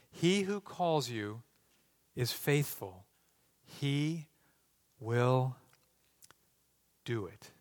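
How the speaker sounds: low, soft, measured reading of a promise; hushed scripture reading. Real, not acted. Preacher reading God's promise to the church, hushed and solemn. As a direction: let each phrase settle quietly.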